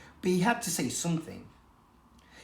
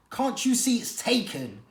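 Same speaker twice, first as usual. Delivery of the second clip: growled; 7 semitones higher than usual. A narrator's reading. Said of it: "she growled".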